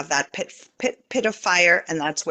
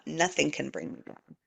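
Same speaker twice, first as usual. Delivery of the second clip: much softer, lower repeat of Nothing; quiet inner affirmation; 4 semitones lower, 9 dB quieter than usual. Shown as a demonstration. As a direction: murmur Nothing to yourself inward. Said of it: "For you to actually declare".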